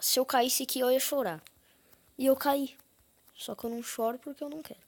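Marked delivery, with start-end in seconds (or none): 0.0-2.8 higher and louder; half-yelled after falling; exasperated, loud; louder frustrated repeat. Real, not acted. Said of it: "Eu ia até falar".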